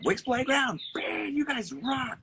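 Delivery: high, slow, drawn-out praise; big fan shout-out; sky-high excited cheer; hyped shout-out. Real, not acted.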